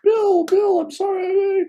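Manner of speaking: high falsetto tearful plea; guru's wife crying. Retold or imitated. Guru's wife sees the injured foot, high tearful apology. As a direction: imitate a weeping woman, pitched far up. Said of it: "she starts crying, tears coming down".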